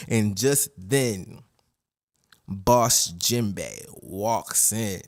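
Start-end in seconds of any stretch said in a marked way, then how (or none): none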